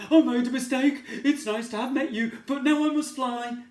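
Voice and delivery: high, loud nervous voice; frightened dragon babbling; scared dragon. Acted. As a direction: hurry to get away. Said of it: "started to shake".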